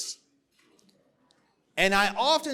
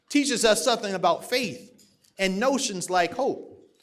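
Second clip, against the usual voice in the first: ordinary delivery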